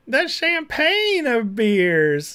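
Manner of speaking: high, slow, drawn-out mocking voice; mock Kamala reading. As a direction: stretch it out like a fake reading of the label. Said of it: "And then she says".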